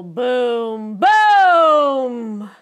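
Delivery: usual speaking voice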